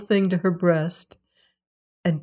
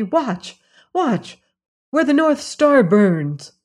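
higher, pressing cat voice; urgent calling; 7 semitones higher, 4 dB louder, slower than usual. A narrator's reading. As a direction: point at the sky, eager. Tommy urges Mother Hulda.

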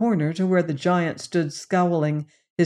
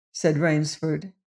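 ordinary delivery

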